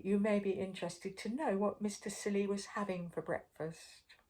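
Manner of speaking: ordinary delivery, nothing marked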